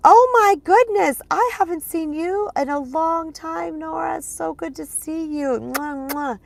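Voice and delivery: high, loud, slow delighted hello with air kisses; gushing greeting. Pretend. Greeting her friend Nora after a long time, gushing and high. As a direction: gush over an old friend you haven't seen in ages.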